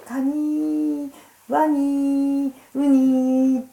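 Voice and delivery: カニー、ワニー、ウニー each held high and long on "ee"; stretched final syllables. Shown as a demonstration. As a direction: lead a group drill, sustaining each ending of カニー、ワニー、ウニー. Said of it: "ちょっと二が伸ばすんですよ". (カニー、ワニー、ウニーの語尾を高く長く伸ばす)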